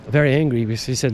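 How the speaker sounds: ordinary delivery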